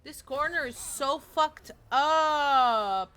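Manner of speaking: loud, drawn-out yell of frustration; exasperated yell. Real, not acted. Gamer complaining about a bad corner, exasperated yell.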